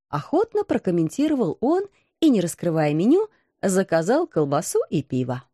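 ordinary delivery

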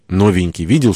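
plain reading voice

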